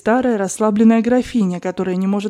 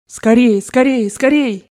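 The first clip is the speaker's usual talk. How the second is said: urgent; 3 semitones higher, slower than usual. A narrator's reading. Grandmother hurrying the narrator.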